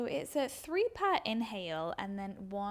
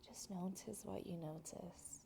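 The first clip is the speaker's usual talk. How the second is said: hushed, much softer whisper; 3 semitones lower, 13 dB quieter than usual. Shown as a demonstration. Teacher inviting students inward, soft whisper. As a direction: barely breathe the words. Yoga teacher guiding introspection.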